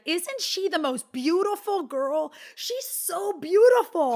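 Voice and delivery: put-on gushing child voice. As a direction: imitate a little girl in awe, stretching the words. Mother voices her child admiring.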